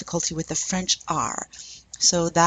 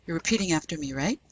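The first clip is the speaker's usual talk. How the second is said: ordinary talk, nothing marked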